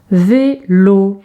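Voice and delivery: "vélo" in two separated beats; drawn-out teacher's demonstration; syllable by syllable. Shown as a demonstration. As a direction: stretch each syllable for young readers. Syllable exercise, picture word.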